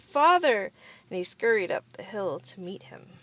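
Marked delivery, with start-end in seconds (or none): 0.0-0.7 high, loud glad cry of Father; joyful shout. A narrator's reading. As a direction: call out Father with joy. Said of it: "he shouted".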